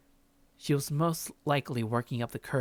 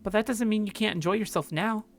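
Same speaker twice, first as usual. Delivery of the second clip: put-on higher, bright friendly young woman; Grace's voice; 9 semitones higher than usual. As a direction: play Grace as cheerful and curious.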